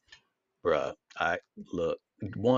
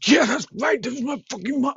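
mock angry brother; 13 semitones higher, 5 dB louder than usual. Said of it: "And he said".

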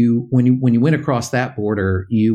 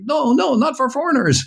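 bloodied driver; 14 semitones higher than usual. Retold or imitated. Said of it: "He's like".

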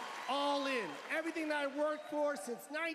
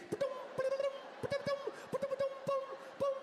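high shouted ba-doom chimes; rapid mock alerts; much faster than usual. High rapid phone pings as kids run past. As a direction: fire off the pings in a panic.

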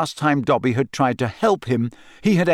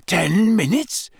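croaking; 7 semitones higher, slower than usual. A narrator's reading. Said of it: "Harry croaked".

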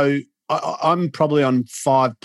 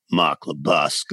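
much lower, grave imitation of a phone greeting; mock undertaker; 9 semitones lower than usual. Guest mocking how the host answered the phone, deep undertaker voice. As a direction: drop into a deep funeral-director voice. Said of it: "have I rung an undertaker?".

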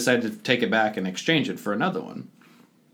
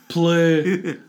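put-on a louder Russian-accented command of Play; mock Russian accent; 5 semitones higher, 4 dB louder than usual. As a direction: say Play. as a short, punched Russian-accent order. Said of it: "you talk to it in a Russian accent".

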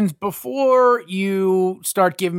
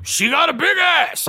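mock shout; 10 semitones higher than usual. Imitating Pacino shouting the line. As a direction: bark the line like Pacino hamming it.

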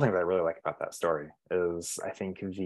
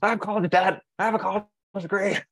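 much higher indignant insistence; protest, pitched way up, half laughing; mock exasperated protest; 12 semitones higher than usual. Insisting he is adult.

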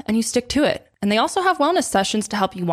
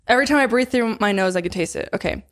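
usual speaking voice

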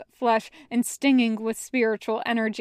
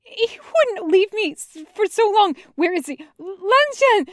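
high, cracking, desperate plea; voice breaking; 9 semitones higher, 6 dB louder than usual. Acted. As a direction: beg through a breaking voice. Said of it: "voice breaking".

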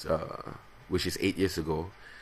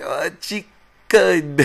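slowed substitute word; 11 semitones higher, 9 dB louder, much slower than usual. Retold or imitated. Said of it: "so I have to stop, like".

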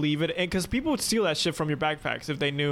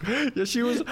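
normal talking voice

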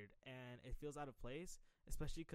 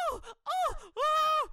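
mock yelp, way higher and louder; high-pitched yelping; 17 dB louder, much slower than usual. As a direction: squeal like a scared kid. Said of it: "that dude yelping".

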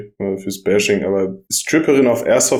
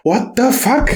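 high, stunned exclamation; shocked disbelief; 11 semitones higher, slower than usual. Retold or imitated. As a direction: blurt it out in shock. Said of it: "ich war so".

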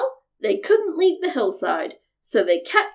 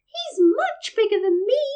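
high, coaxing little goat; small goat voice; 7 semitones higher than usual. A narrator's reading. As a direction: bargain in a tiny high voice. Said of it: "with such a small voice".

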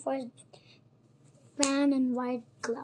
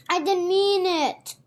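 loud, high-pitched plea; pleading; 5 semitones higher, 7 dB louder than usual. A narrator's reading. Alice begs the offended mouse, pleading. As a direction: plead your innocence, raised and urgent. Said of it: "Alice pleaded".

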